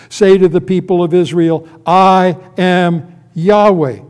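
loud proclamation slowing on the name. Acted. God sends word to Israel, proclaiming. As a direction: proclaim it, stretching out each final word. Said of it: "repeat this statement four times".

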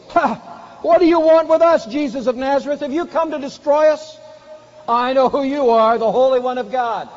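high, loud cry at the top of the voice; shouting demoniac. Acted. Possessed man cries out at Jesus in the synagogue, top-of-voice shout. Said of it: "He cried out at the top of his voice".

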